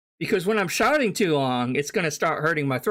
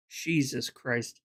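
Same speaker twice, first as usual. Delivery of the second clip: exasperated; 6 semitones lower, 6 dB quieter, slower than usual. Real, not acted. Exasperated at the clip.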